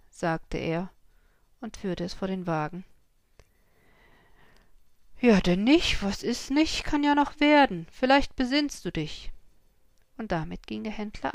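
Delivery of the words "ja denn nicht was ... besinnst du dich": loud, higher, mocking dealer; veiled threat. A narrator's reading. Horse dealer taunting the farmer, loud and mocking. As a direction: toss a threat over the shoulder.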